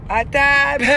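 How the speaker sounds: loud, high call of À table; shouting À table for dinner. Retold or imitated. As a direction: yell À table down the hall like every evening. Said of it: "je crie à table".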